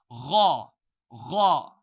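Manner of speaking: "Raw, raw." rolled from the throat like a gargle; gargled ra. Shown as a demonstration. Teacher demonstrates the gargled ra. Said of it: "you should gargle".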